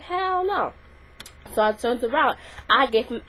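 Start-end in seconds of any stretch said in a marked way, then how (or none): 0.0-0.7 higher, sassy "hell no"; sassy refusal. Retold or imitated. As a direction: snap it back with attitude. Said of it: "I wasn't gonna take that".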